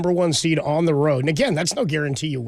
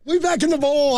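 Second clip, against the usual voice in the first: hyped; 11 semitones higher, slower than usual. Real, not acted. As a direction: fire up the room. Cohost cheers team's win.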